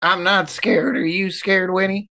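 usual speaking voice

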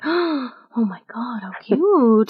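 hushed, gasping coo over something adorable; mock swoon at a cute pet; retold gushing. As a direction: draw it out in awed delight. Retold gushing over clients' pets.

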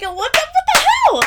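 retold outraged yell, high and loud; exasperated shout. Retold fury at closed pumps.

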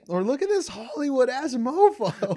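high, loud mocking jeer; mock him loudly. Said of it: "You wanna capitalize".

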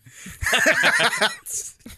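a big high laugh, cracking up; high burst of laughter. Real, not acted. Dad laughing at his own Superman joke to his son.

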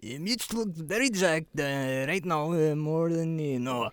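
put-on slow Borat-style Russian accent; high, drawn-out mock Russian voice. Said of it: "It's like Borat".

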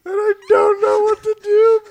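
very high, loud falsetto wail; overwhelmed parent. Acted. Revived parent at a loss with too many names, falsetto wail.

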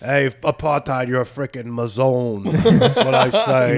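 slow, drawn-out Italian American wiseguy; Sopranos mobster accent. Pretend. Comic riffing on apartheid in a slow mock Sopranos accent. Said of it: "like, a, The Sopranos?".

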